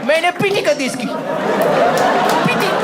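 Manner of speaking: put-on high, loud lisping toddler voice; baby talk. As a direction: tattle like a toddler. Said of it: "तोतला बोलना".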